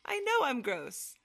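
mock-own it sarcastically; high sarcastic agreement. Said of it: "And I'm like".

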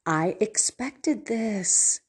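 mild knowing stepson, slowed right down; resigned stepson. Retold or imitated. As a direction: let it land slowly, like he saw it coming. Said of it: "And so he said".

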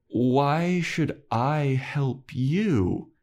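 passive-aggressive. Retold or imitated. Giles demands payback from Spike, drawn-out passive-aggressive. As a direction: draw the question right out to make them squirm. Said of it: "passive aggressive".

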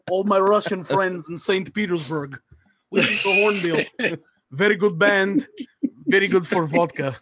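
hammy Russian goalie voice; Russian accent. Retold or imitated.

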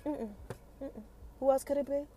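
normal talking voice

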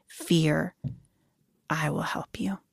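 soft breathy whisper of a promise; tender whisper. Acted. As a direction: breathe it like a gentle comfort. God's tender promise quoted.

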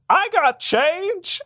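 very high, slow "I got change!" yelled out; excited yell. Pretend. Pitching a tagline for the fake ad, high slow excited yell. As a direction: squeal it out like an ad tagline. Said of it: "someone should yell".

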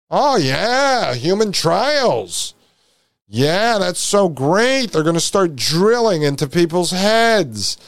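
sarcastic enthusiasm. Pretend. Mocking those welcoming human trials, sarcastically enthusiastic. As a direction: stretch the words in pretend delight.

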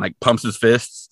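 usual speaking voice

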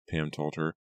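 usual speaking voice

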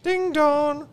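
high sung ding dong; doorbell voice. Acted. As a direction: sing it like a doorbell. Doorbell sound effect in skit.

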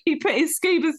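ordinary delivery

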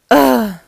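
loud groan of disgust; disgusted ugh. Acted. Jim groaning at the singing, loud and fed up. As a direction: groan like the noise hurts.

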